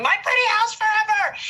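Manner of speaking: put-on kids screaming. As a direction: yell it like a child claiming a house. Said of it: "while they're screaming".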